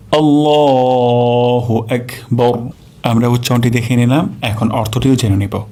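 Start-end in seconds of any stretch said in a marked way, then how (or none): 0.1-2.7 আল্লাহু আকবার drawn out very slowly; a slow, careful recitation demo. Shown as a demonstration. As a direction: chant আল্লাহু আকবার with every sound lengthened. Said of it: "আরেকবার উচ্চারণ করছি".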